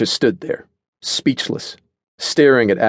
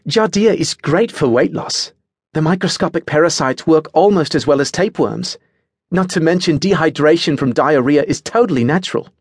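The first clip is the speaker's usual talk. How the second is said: higher-pitched, blithely informative character voice; matter-of-fact Adelaide; 8 semitones higher than usual. A narrator's reading. Adelaide recommends parasites for weight loss, matter-of-fact.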